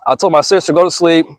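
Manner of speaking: ordinary delivery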